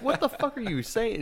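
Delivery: very high mock-incredulous voice; exasperated bafflement. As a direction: retell it as a high, baffled plea. Retold baffled reaction to dialect.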